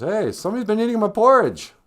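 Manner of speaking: a hint of an affronted storybook bear; indignant Papa Bear. Papa Bear's complaint acted out.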